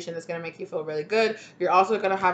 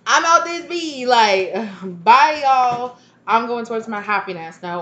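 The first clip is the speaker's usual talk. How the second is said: high, loud and slow, waving it all off; gleeful goodbye; 4 semitones higher, 7 dB louder, slower than usual. Retold or imitated. You walking out free, high and loud gleeful farewell. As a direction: sing out a joyous goodbye to your burdens. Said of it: "and you're like".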